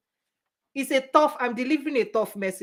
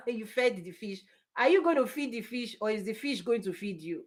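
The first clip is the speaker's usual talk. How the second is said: ordinary talk, nothing marked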